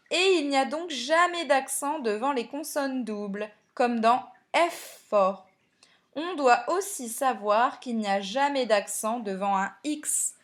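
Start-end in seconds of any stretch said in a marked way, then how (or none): 4.5-5.5 "effort" broken as ef-fort; split double consonant. Shown as a demonstration. Teacher showing a double consonant, "effort" split as ef-fort. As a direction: pause inside "effort" between the two f's. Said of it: "on sépare toutes les consonnes doubles".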